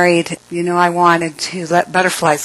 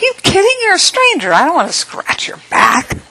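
incredulous; 11 semitones higher than usual. Retold or imitated. Refusing a stranger's odd request, high incredulous protest. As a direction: react like it's an absurd request. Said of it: "I'm like".

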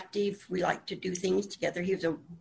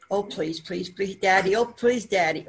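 imitating a begging daughter, quick rising urgency; mock plea, louder on the later Daddy and please; pleading; 4 dB louder than usual. Daughter begging her dad, acted out.

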